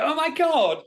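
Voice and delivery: high, loud horrified woman; hammed-up horrified diner, pitched high; mock shriek. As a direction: squeal in mock disgust. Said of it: "got up and go".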